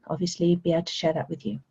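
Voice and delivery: usual speaking voice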